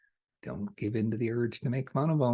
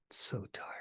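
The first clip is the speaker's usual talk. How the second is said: softer weary whisper; 7 dB quieter than usual. Acted. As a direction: sigh it out under your breath. Soft aside on tiring mind.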